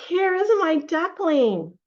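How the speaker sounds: high, loud, drawn-out happy find; delighted discovery. Acted. Storyteller voicing a duck finding her duckling, delighted. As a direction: exclaim it as a mother duck.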